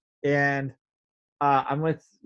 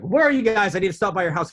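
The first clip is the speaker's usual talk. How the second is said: loud, raised-pitch phone demand; urgent plea; 5 semitones higher, 7 dB louder, faster than usual. Retold or imitated. Man phoning his buddies in stomach distress, urgent. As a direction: call out as if you can't wait. Acts out urgent call to friends.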